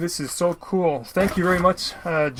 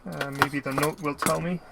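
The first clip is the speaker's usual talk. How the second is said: ordinary talk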